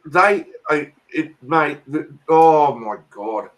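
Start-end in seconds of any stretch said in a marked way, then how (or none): none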